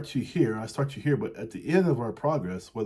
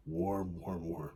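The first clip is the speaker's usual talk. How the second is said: fading to a hush; 4 semitones lower, 8 dB quieter, slower than usual. A narrator's reading. Bird cosy in the cow pie.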